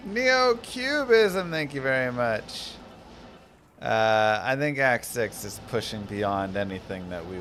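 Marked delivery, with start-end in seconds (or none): 0.1-1.5 high drawn-out announce. Acted. Reading donor name from chat.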